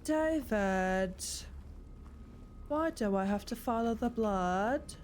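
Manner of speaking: drawn-out, theatrical complaint; Moira. Pretend. Streamer playing at following blood in a game, hammed-up Moira voice. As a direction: stretch the name out like a put-upon diva. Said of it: "so much more like Moira from".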